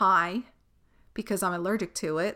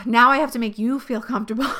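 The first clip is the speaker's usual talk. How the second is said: exasperated sigh; 3 semitones higher, 6 dB louder, faster than usual. Real, not acted. Exasperated sigh about comforting others.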